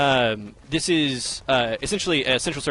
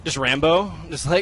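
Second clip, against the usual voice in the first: loud; 5 semitones higher, 6 dB louder than usual. Real, not acted. Calling out during gameplay.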